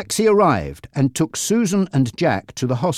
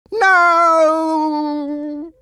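high, loud cry of denial; a boy yelling at terrible news; shouted; 4 dB louder than usual. Acted. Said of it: "shouted Jack".

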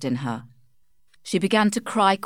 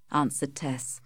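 usual speaking voice

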